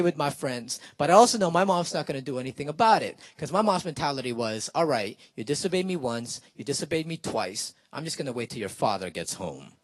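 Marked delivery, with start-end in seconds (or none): 4.7-9.7 lower, softer voice of a mother biding her time; mock calm threat. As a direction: drop low and quiet, a menacing promise. Mom's restrained threat, retold.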